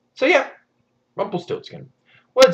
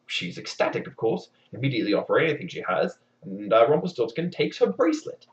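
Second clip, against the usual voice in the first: ordinary delivery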